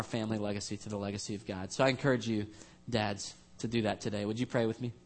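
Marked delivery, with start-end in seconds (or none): none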